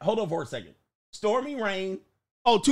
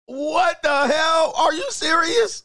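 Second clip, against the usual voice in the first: shocked shout; 9 semitones higher, slower than usual. Real, not acted. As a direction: yell it in amazement. Shocked by phone effect.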